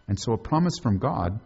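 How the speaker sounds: ordinary talk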